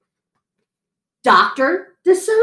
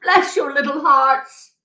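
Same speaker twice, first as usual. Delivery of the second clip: higher, pleading fox voice; fox crying out; 5 semitones higher than usual. A narrator's reading. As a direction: gush gratitude in a put-on fox voice. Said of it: "he cried".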